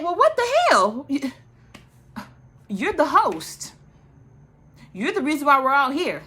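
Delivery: high, incredulous retold confrontation; exaggerated affronted protest; mock indignation. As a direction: act out calling someone out, high and emphatic. Retells Kandi confronting Kenya.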